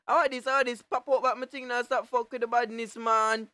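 usual speaking voice

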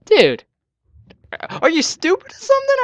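sneering jump up high; scornful retort. Retold or imitated. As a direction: scoff at him in disbelief. Mocking friend in retold chat.

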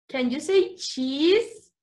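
ordinary talk

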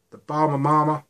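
high, loud mock South American accent on Bahama Mama; better South American accent. Vlogger retries the juice name in a South American accent. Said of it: "The accent's getting better".

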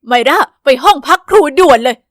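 loud furious command; angry principal. Acted. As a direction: order her to the office, fuming. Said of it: "ครูดูโกรธยิ่งกว่าเมื่อวันก่อน".

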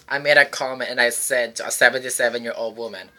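ordinary delivery

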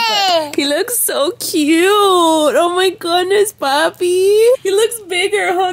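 high, loud, slow stretched gush. Real, not acted. Mother gushes to viewers about her son's new look, loud and stretched. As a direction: swoon over how cute he is.

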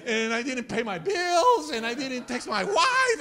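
loud, high hammed-up panic; comic last-minute panicking; mock scared. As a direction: wail like a doomed passenger. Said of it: "like, scared".

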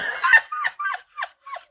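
loud high burst of laughter; big laugh. Real, not acted. Laughing after heavy card.

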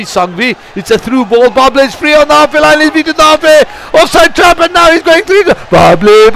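high, loud goal call; excited play-by-play. Real, not acted. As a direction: shout it out as he breaks through. Attack leading to goal.

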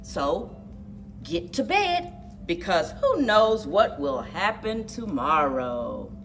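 lively picture-book read-aloud; reading to children. A narrator's reading. Narrator reading a kids' exercise book, lively. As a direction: read it like a storybook for kids.

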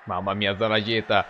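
ordinary delivery

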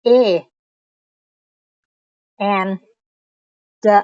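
A, and, the said slowly and separately; English words shown. Shown as a demonstration. Teacher reading A, and, the aloud to beginners, slow and clear. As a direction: spell out A, and, the one by one. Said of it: "ตัวนี้เห็นบ่อยๆ สามคำนี้".

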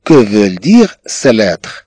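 slow dictation. Shown as a demonstration. Teacher dictating French question.

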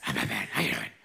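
low, husky tough-guy greeting; gruff Batman voice. Retold or imitated. Pastor imitating Batman to the church, low growl. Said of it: "And he talks like that".

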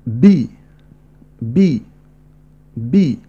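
بي blended, clear French i; syllable drill. Shown as a demonstration. Teacher drilling learners: clear بي. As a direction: repeat بي crisply. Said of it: "سأنطقها".

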